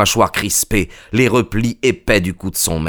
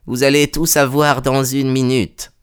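lightly voiced servant woman, pitched up; a higher, restrained character voice; Madame Toller, coopérative; 5 semitones higher than usual. (domestique, voix un peu plus aiguë)

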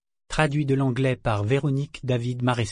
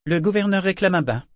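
cutting tone; 8 semitones higher, 4 dB louder than usual. Acted. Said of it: "dit-elle sur un ton tranchant".

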